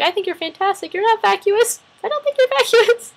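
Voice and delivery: very high, gushing reassurance; imitating a nice reassurer. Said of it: "she was so nice".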